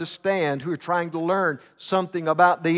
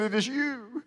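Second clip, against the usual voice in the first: softer, higher quiet reveal; hushed accusation; 6 semitones higher, 9 dB quieter than usual. Retold or imitated. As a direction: drop the voice to accuse. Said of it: "He said".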